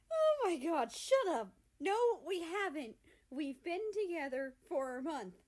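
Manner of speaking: high, loud embarrassed protest; flustered exclaim. A narrator's reading. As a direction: burst out, all flustered and loud. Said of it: "he exclaims".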